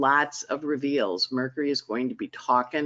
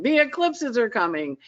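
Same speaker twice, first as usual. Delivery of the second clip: higher, ringing call of alarm; herald's cry; 8 semitones higher than usual. Acted. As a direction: call it out like a town crier. Repeated announcement, Paul Revere style.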